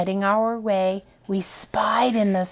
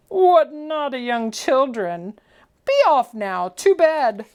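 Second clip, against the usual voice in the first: louder, jolly mock scolding; smiling Santa; 4 semitones higher, 4 dB louder than usual. Santa sending the children to bed, jolly mock scold. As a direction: scold them fondly as Santa. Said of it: "but smiled as he said".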